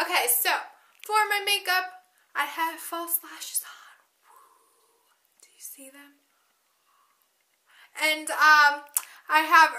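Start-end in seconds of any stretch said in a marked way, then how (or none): 2.3-6.2 hushed whisper, slowed down. Acted. Vlogger showing her lashes to viewers, whispered. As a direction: lean in and breathe it to the viewers.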